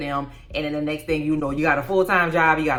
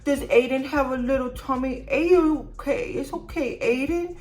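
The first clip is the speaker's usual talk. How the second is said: mock baby talk; 8 semitones higher than usual. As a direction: coo at the baby like a doting mom. Mocking her cooing to baby.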